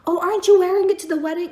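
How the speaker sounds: mock maid, quick and high. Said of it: "She was like".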